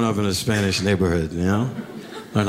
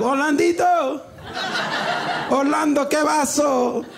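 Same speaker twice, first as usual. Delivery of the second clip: excited Dominican neighbors. Retold or imitated. Neighbors greeting him by name with an excited high Spanish shout. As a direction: shout his name like old friends. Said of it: "They'll be like".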